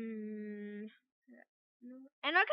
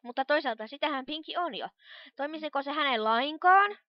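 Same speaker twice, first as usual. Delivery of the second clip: normal talking voice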